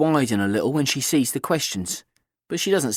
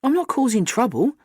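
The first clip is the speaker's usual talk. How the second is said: airily; 12 semitones higher than usual. A narrator's reading. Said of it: "she says airily".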